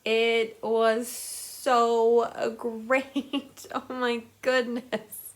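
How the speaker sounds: drawn-out, loud gush of delight with a laugh; breathless, laughing book love. Real, not acted. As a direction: rave about the book, stretching every word. Gushing over a finished book.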